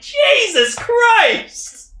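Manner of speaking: high, long drawn-out cry of disbelief; shocked exclamation. Retold or imitated. Podcaster retelling reaction to her getting sucked into space, shocked exclamation. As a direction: stretch it out in appalled astonishment.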